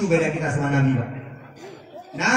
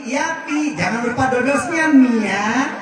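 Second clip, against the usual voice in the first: cutesy wife; 6 semitones higher, 7 dB louder, slower than usual. Acted. Wife answers with pet names.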